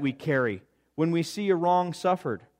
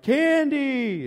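imitated crowd call; 11 semitones higher than usual. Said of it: "everyone's like".

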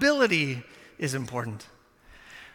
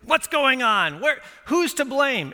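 a hint of an upset parent, pitched higher; lightly voiced worried parent asking who is to blame; parent demanding answers; 6 semitones higher, slower than usual. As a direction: demand to know who did it. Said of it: "As a parent, you wanna know".

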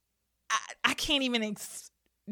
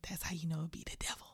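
whispered aside, much softer and quick; 7 semitones lower, 15 dB quieter, much faster than usual. Real, not acted. Whispering a quick aside to her listeners. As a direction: lean in and whisper it fast like a secret.

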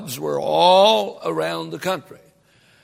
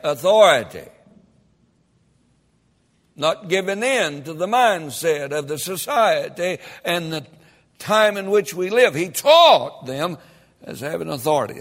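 louder, rising preacher's cadence, punching phrases; preaching at full force; 4 semitones higher, 6 dB louder than usual. Real, not acted. Preacher stirring up the congregation, fiery and loud.

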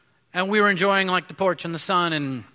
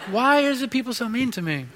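imitating tax collector; 7 semitones higher than usual. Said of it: "I was like".

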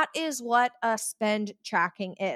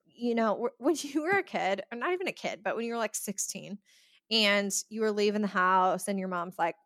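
usual speaking voice

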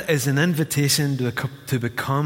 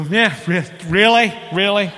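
higher, louder mock incredulity; sarcastic disbelief; 6 semitones higher, 5 dB louder than usual. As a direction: roll your eyes with each really. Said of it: "you wanna go".

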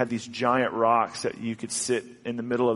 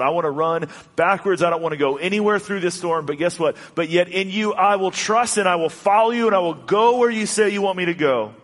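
rising resolve; 6 semitones higher, 4 dB louder than usual. Acted. Voicing believer's resolve.

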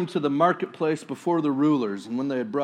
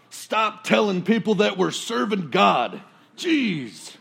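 higher, louder fed-up outburst; exasperated; 7 semitones higher, 6 dB louder, slower than usual. Retold or imitated. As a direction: blurt it out as the annoyed apostle. Paul fed up with slave girl.

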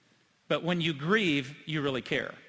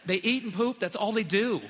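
mock scoff; 4 semitones higher than usual. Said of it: "you're sitting here going".

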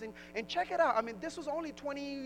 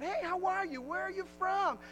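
higher-pitched casual greeting; greeting a new acquaintance; 7 semitones higher than usual. Acted. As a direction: imitate a curious stranger making small talk. Acted small talk on meeting someone.

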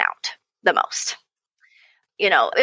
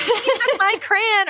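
put-on high, whining child complaint; high kid whine; whiny kid; 13 semitones higher than usual. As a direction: whine like a kid who lost a crayon. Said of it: "there's, like".

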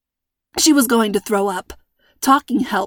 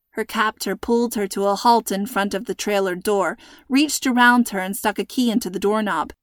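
plain reading voice

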